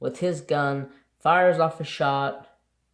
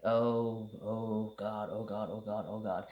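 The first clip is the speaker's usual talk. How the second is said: scared muttering; 3 semitones lower, 8 dB quieter than usual. Retold or imitated. Backing away from a stalking lion.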